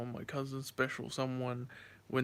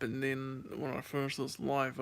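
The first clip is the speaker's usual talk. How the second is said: ordinary talk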